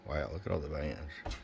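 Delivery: usual speaking voice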